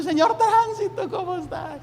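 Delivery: high, cheery mock greeting; hammed-up happy greeting; overjoyed greeting. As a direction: beam at the traffic cop. Happy man greets traffic cop.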